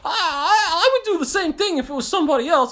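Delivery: put-on high, loud whining kid near tears; whiny kid voice. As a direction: whine it like a hurt kid. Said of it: "And he's like".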